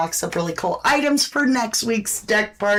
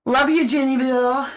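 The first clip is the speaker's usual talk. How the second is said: normal talking voice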